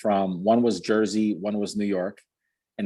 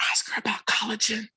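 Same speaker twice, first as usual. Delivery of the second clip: high mock whisper; whispered aside; 12 semitones higher than usual. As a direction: whisper a tip so the caller can't hear. Coach whispering aside during call.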